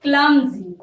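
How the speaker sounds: Clumsy said out clearly as KLUM-zee; clear model word. Shown as a demonstration. Teacher modelling the answer word for students, clear. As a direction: give Clumsy a crisp, clear model read. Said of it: "क्या कहेंगे?".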